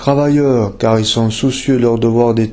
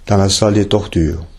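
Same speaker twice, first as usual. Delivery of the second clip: plain reading voice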